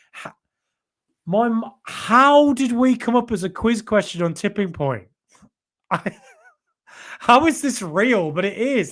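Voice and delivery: high, slow, stunned "how is this real"; amazed disbelief. Real, not acted. Streamer amazed his channel was a Tipping Point question, high and slow. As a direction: let the disbelief lift the voice.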